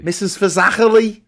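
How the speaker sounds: Scouse Fazachely with a throaty ch; Scouse accent. Shown as a demonstration. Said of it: "best word to say in a Scouse accent".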